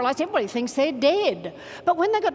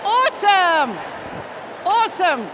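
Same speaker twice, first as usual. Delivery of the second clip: cheering praise; 4 semitones higher, 4 dB louder than usual. Real, not acted. Coach applauds buddies' effort, loud cheering praise. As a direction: call it out to the whole room.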